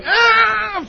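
sudden high shriek of fright; startled scream. Retold or imitated. Parent shrieking as the child runs into traffic. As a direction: cry out as the car nearly hits the child.

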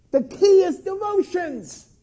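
high, loud over-eager proclamation; hammed-up fervent shout; mock zealous shouting. Mimics people who overrate devotions.

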